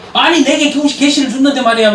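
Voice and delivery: mock-annoyed retelling of her outburst; retold temper. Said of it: "막 성질을 내더래". (성질내는 말투를 가볍게 흉내)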